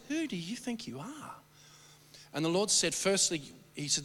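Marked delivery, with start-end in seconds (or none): 0.1-1.3 higher lightly voiced taunting spirit; a hint of a sneering demon; mock spirit challenge. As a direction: toss the challenge back mockingly. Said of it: "it was like this".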